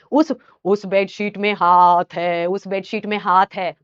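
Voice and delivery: low, slow eerie voice; possessed girl. Retold or imitated. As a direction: speak like a possessed girl, slowly. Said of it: "ये बंदी वहां कह रही है".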